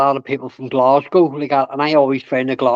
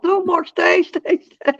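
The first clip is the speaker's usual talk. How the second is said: high, pleading call to stay; pleading Darrell; slower than usual. Retold or imitated. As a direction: beg him to stay, pitched high. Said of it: "and Darrell's".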